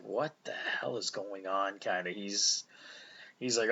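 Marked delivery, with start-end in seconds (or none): 0.0-1.8 baffled Vision. Retold or imitated. Vision confused by chaos.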